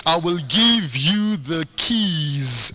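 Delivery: "keys" stretched long to bring out the plural; slow, deliberate build onto a drawn-out "keys"; stress on keys. Real, not acted. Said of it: "in plural".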